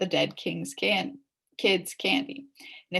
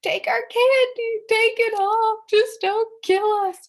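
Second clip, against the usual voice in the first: much higher and louder, slow pleading cry; panicked yell; 12 semitones higher, 7 dB louder, slower than usual. Acted. Ryan begging the monster to spare them, panicked yell. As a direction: beg for your life in terror. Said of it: "Ryan yelled".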